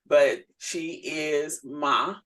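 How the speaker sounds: ordinary delivery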